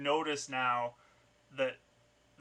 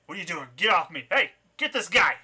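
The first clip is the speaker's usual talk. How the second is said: raised, indignant yell building to a loud call; tackled thug's outraged shouting, higher and louder; angry thug shouting; 5 semitones higher, 12 dB louder than usual. Acted. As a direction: protest loudly, then holler for your gang. GM voices tackled thug calling accomplices.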